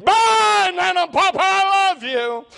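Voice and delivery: imitated little granddaughter's high, loud farewell; child calling out. Granddaughter calling to grandparents past airport security, imitated shout. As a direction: shout it high, stretching out the last words. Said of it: "begin to jump and say".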